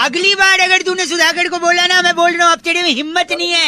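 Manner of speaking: high, worked-up put-on outrage; mock-angry threat. Prank caller threatening callee over his friend, mock angry. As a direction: threaten him in a high, indignant voice.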